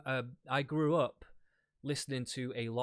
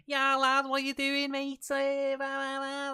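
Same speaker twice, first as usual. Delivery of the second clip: put-on high-pitched, bouncy young Scouse accent, pitched far up; chirpy Liverpool lad's Scouse accent, way higher and louder; 5 dB louder, slower than usual. Shown as a demonstration. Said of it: "the Scouse accent is more like".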